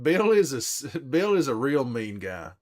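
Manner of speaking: laughing. Real, not acted. Laughs while reading comment.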